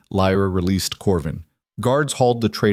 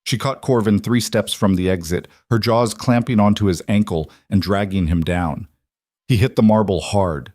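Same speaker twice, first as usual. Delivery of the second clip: usual speaking voice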